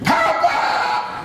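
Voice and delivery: very high, drawn-out scream imitating the father; screamed call. Father calling to grandfather at the plane door, screaming. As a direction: cry it out across a great distance. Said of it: "He starts screaming".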